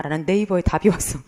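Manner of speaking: usual speaking voice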